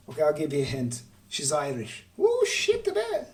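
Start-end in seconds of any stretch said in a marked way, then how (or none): 2.2-3.3 squealed very high; sudden high-pitched yelp of alarm; startled squeal. Real, not acted. Startled by the bed, a very high squeal. Startled mid-story aside.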